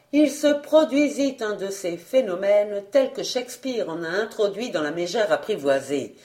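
plain reading voice

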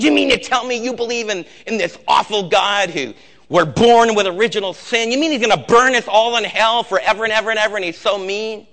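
high, shouted mock outrage; in-your-face confrontation. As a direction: imitate an irate professor yelling in a student's face. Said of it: "got right in her face".

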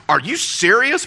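high, loud disbelieving question; acted amazement, pitch leaping up at the end; incredulous. Gary to the stewardess on his upgrade, incredulous.

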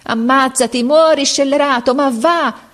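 high, strained, raging cry; furious urging. A narrator's reading. Captain cursing the lagging drummer boy, furious urging. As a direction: curse him onward, voice held high throughout. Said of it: "stringendo i denti e i pugni".

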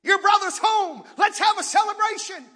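high, loud fatherly welcome; father calling his son to the celebration; joyful invitation. Acted. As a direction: call the son in to the party. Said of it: "Dad said".

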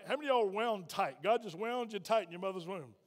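usual speaking voice

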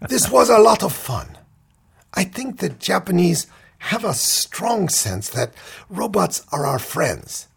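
put-on lightly high Japanese accent; a hint of Japanese accent. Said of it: "said the bride".

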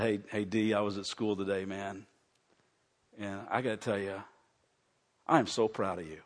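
soft, low, tender father's voice; choked-up proud dad. Retold or imitated. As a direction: quietly tell your son you're proud, moved. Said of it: "I got so choked up".

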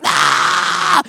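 loud high panicked yell; mock scream. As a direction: scream like scared kids home alone. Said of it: "is just screaming".

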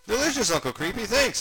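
put-on high, loud child character voice; high-voiced, cheerful little sidekick; Gunga kid character. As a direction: pitch it up like an eager kid. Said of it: "said Gunga".

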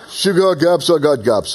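put-on Rocky's line redone in a light Russian accent; mock Russian accent. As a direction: try Philly, land somewhere in Russian. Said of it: "it's a little Russian".

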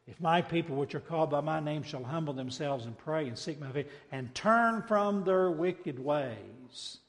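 ordinary delivery

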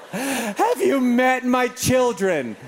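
slow, drawn-out disbelief after laughing; incredulous laughter. Retold or imitated. Dad answering doctor's "be gentle", slow and incredulous. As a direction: scoff at a hopeless suggestion.

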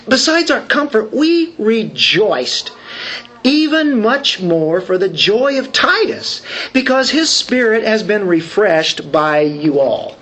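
preacher reading Paul's letter to the congregation in a Southern accent. Shown as a demonstration. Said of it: "There we go with our southern accent again".

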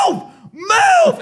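very high yelled move; retold shout. Yelling at the man blocking the stage. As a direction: shout him out of the way. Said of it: "And I'm just like".

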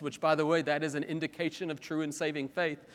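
ordinary talk, nothing marked

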